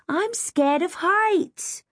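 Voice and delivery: high, slow, fearful whine; frightened wail. A narrator's reading. Kim confessing her fear from the ladder, wailing. Stuck girl admits fear of heights.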